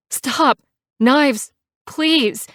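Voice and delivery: high, loud, frightened pleading; nearly sobbing. A narrator's reading. Scared passenger begging biker to stop, near-sobbing plea. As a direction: beg desperately, on the edge of a sob. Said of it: "I nearly sob".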